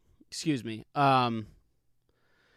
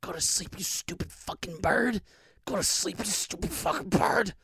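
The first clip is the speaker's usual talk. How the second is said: menacing lullaby whisper; hushed, hissed mock lullaby; 3 semitones higher, 6 dB quieter than usual. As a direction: whisper it right into the ear while choking him. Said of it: "I whisper in his ear".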